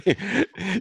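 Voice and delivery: high laugh; short burst of laughter. Real, not acted. (risata improvvisa)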